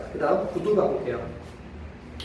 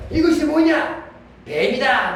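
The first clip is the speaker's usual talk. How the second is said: jokey high shout, louder; comic shout; 7 semitones higher, 4 dB louder, slower than usual. Retold or imitated. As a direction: yell it like a tipsy prankster. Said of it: "익살스럽게 외친다".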